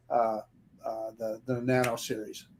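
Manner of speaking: usual speaking voice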